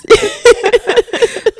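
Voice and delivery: bright burst of laughter; loud high laugh. Real, not acted. Laughs again.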